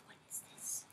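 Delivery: murmuré pour soi, sans voix; whisper. Real, not acted. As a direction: chuchote la question, intriguée. Whispered to herself, then repeated.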